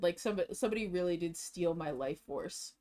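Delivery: ordinary talk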